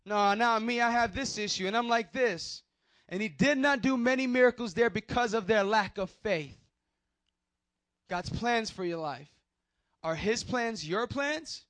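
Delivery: high, loud preacher's voice; full-force preaching. Acted. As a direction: preach it out to the whole room. Preaching opener, raised voice.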